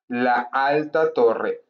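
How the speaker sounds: "La alta torre" drawn out very slowly, each syllable clear; slow model repeat. Shown as a demonstration. Teacher to students, repeating "La alta torre" very slowly. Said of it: "yo digo".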